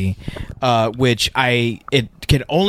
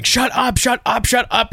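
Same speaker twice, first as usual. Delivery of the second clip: much higher, snapping shut up over and over; villain yelling shut up; 11 semitones higher than usual. Retold or imitated. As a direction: cut him off with high sharp shut ups. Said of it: "Christopher Guest keeps going".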